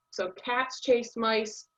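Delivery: slow, with cats, chase and mice each stressed. Shown as a demonstration. Teacher repeating the example sentence, slow and stressed. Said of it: "that's where the stress is in the sentence".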